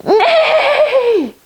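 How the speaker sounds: high neigh with a laugh; whinny like a horse. Pretend. Horse neigh in kids yoga.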